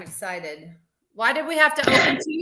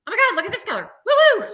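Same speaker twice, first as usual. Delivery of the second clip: imitating a high, sped-up chipmunk; 7 semitones higher, 6 dB louder, much faster than usual. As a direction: mimic a friend's squeaky commentary. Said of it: "Sherilyn loves the chipmunk voice too".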